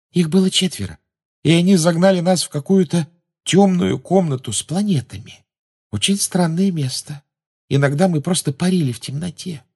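put-on high-pitched girl's storytelling voice; dreamy girl Luna. A narrator's reading. Luna tells Harry about the chase, high-voiced. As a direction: recount it in a high girlish register.

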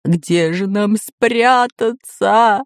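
slow, drawn-out mock sob; Бульбуль crying with fear. A narrator's reading. Pirate cornered by dinosaur, crying with fear. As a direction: whine it, stretching every word. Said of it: "плакал от страха Бульбуль".

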